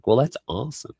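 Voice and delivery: ordinary talk, nothing marked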